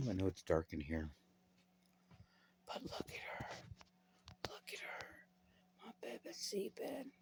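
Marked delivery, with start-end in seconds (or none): 2.7-7.1 whispering. Real, not acted. Filming a sleeping baby.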